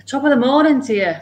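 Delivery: high, cheery Irish greeting; lightly put-on Irish lilt; Irish accent. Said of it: "That's a bit Irish".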